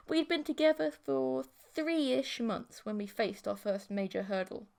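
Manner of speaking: plain reading voice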